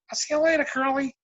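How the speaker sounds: high-pitched mock call; imitating bandmate. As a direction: call it out high, as the tipsy star would. Imitating [name] calling to him.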